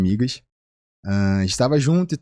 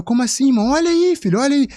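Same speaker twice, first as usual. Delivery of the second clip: very high, loud burst; shouting look at it; excited disbelief; 13 semitones higher, 4 dB louder, faster than usual. Retold or imitated. Excited exchange about the list, very high loud voice.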